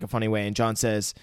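normal talking voice

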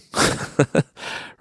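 soft airy laugh; a quiet huff of laughter; breathy chuckle. Real, not acted. Laugh about son's money.

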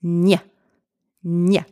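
"nya" as one palatal n-y sound on its own; isolated ny sound. Shown as a demonstration. Teacher models G N E together, isolated ny sound. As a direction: sound out "nya" alone, pausing between takes. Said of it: "G, N, E pronounced together".

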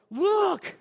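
high, loud call; excited exclamation. Retold or imitated. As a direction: cry it out, captivated. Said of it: "they're like".

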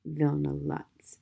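low, slow and soft, spitting the name; like a curse. Acted. Gloria naming the man who wronged Peter, like a curse. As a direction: say the name as if cursing him. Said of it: "as if she were uttering a curse".

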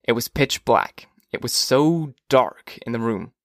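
usual speaking voice

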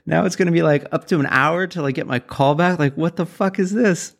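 high, exasperated disbelief; caller's voice jumps high in outraged disbelief; incredulous outburst. Retold or imitated. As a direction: squeak it out like you can't believe the wait time. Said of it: "I'm like".

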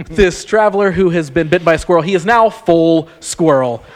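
normal talking voice

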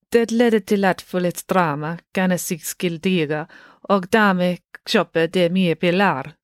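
slow, measured Swedish delivery; speaking Swedish. A narrator's reading. Loretta explains family customs to Ingrid, in Swedish. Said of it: "spoke to Ingrid in Swedish".

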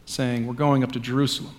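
measured, solemn gospel reading; scripture reading. Acted. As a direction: liturgical reading voice. Reads the gospel passage aloud.

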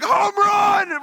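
high-pitched fan shout; hammed-up, drawn-out cheer; ballgame cheer. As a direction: yell it like a fan in the stands. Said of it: "and we're like".

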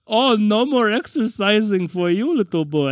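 hammed-up German henchman voice; German accent. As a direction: put on a playful, thick German accent. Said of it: "that was a great German accent".

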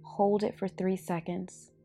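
ordinary talk, nothing marked

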